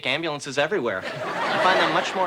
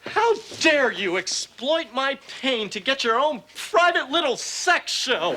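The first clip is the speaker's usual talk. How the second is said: indignant; 8 semitones higher, slower than usual. Acted. Mock outrage at doctor.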